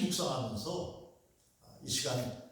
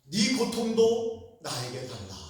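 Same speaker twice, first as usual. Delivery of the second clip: earnest Jesus voice; 7 semitones higher, 6 dB louder, slower than usual. Acted. Preacher voicing Jesus.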